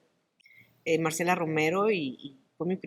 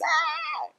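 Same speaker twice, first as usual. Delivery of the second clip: startled cry. Retold or imitated. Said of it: "y dices".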